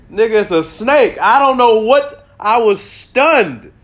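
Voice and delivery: high, loud, drawn-out disbelief; exasperated. Real, not acted. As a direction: stretch it out, stunned and loud. Exasperated about the snake.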